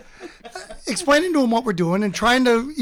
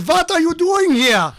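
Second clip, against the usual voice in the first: put-on higher, louder, slow German-accented demand; 8 semitones higher, 5 dB louder, slower than usual. Security guard confronting geocachers, imitated German accent. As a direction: mimic a very German guard challenging intruders. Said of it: "He was very German".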